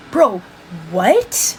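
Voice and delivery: incredulous, pitch leaping up on "what"; stunned disbelief. Real, not acted. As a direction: react in open disbelief. Stunned reaction to article.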